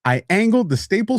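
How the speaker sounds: heated, high-pitched acted rant; pissed off. Worker pulled in over a staple, acted angry protest. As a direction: complain in mounting mock outrage. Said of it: "And you're pissed".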